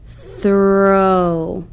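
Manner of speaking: drawn-out Throw; sounded out slowly. Shown as a demonstration. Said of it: "The O W word should be read like this".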